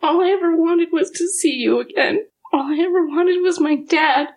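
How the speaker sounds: high, teary pleading; tearful. Acted. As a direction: let the hurt spill out. Daughter confronts lost father.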